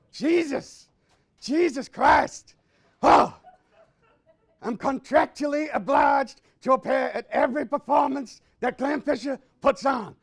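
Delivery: stretched-out furious yell; furious shout. Acted. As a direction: storm in bellowing at everyone. Angry rant shouted at audience.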